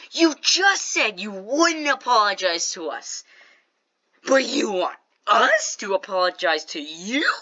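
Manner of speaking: slow, drawn-out mock disbelief; incredulous comeback. Storyteller pointing out the kid's contradiction, incredulous comeback. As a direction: stretch each word out in exasperation.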